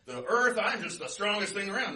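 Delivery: loud, high-pitched boasting; blustery North Wind. Acted. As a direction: brag like a big blowhard. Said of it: "he's really blustery".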